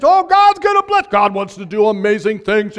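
high, loud mock prophecy; hammed-up church prophet; mock prophesying. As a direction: pretend prophetic proclamation. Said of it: "I prophesied".

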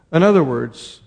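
usual speaking voice

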